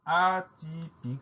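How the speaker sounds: "atypique" high and drawn out syllable by syllable; slow dictation. Shown as a demonstration. Teacher dictates the new word.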